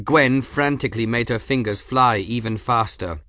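ordinary delivery

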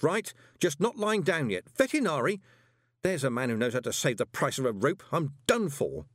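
high, frantic panicky rant; panicked. Acted. Moist panics in dialogue.

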